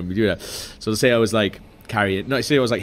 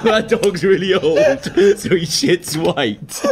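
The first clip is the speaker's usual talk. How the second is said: laughing; 7 semitones higher, slower than usual. Pretend. Voicing dog owner, laughing.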